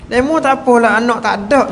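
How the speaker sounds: high-pitched scolding outburst; retold indignant retort. Grieving woman rebuffing the Prophet, acted high indignant retort. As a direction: throw it back, high and indignant.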